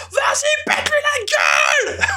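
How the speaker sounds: high, shouted cheer at the screen; excited kid yell. Retold or imitated. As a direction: yell it like a twelve-year-old fan. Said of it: "je saute en disant".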